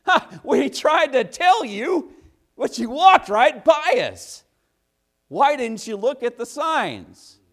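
high, loud, slow taunting sing-song; mocking signs. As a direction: taunt him like a gloating sign. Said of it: "They were sitting there laughing at me".

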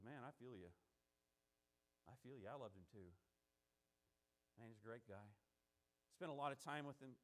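very low, soft, casual consoling voice; quiet sympathy. Acted. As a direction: console a grieving friend in a hushed, low voice. Voices Jesus consoling the mourners.

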